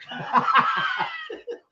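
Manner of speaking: very high, shrieking burst of laughter. Real, not acted. Joking with friends about getting smacked, high shrieking laugh. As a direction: let out a squealing laugh. Warning friend not to judge.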